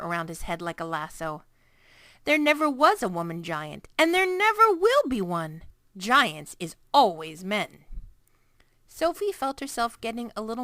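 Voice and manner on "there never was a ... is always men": insistent loud. A narrator's reading. Giant insisting to Sophie, getting louder. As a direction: lay down the law.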